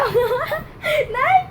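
long high shrieking no; drawn-out laughing shriek. Real, not acted. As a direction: wail it out, laughing. Protesting in the game.

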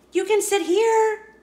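high, eager offer; Penelope offering a seat to a classmate, high and eager; hopeful little girl. Acted. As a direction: sound keen to make a friend.

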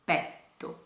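"Petto" whispered, double T held. Shown as a demonstration. Teacher whispers "Petto" for students. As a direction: breathe "Petto" with no voice. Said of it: "Double T".

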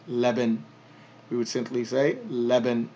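"Leban" ending dropped, stopping on the n; pausal Leban. Shown as a demonstration. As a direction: end "Leban" cleanly on the n. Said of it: "we're not gonna pronounce that".

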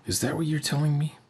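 usual speaking voice

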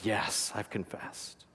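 soft whispered reply, barely voiced; hushed whisper. Mark whispers his reply to the pastor, hushed and barely voiced. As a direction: breathe the answer out in a whisper.

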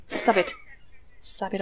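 ordinary delivery

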